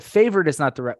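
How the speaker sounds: normal talking voice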